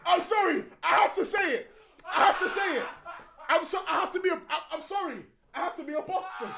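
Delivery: high, loud insistence; worked-up protest. Real, not acted. Refusing to back down as friends walk out, worked-up protest. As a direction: insist over and over at high pitch. Ranting while others leave the room.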